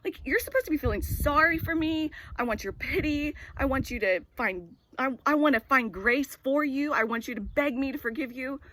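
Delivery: high, loud, outraged retelling of herself; hammed-up indignation, demanding pity; indignant rant. Mother replaying her rant at her son, mock outrage. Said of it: "And I'm like".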